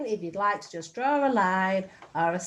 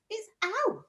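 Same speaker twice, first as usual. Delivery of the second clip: normal talking voice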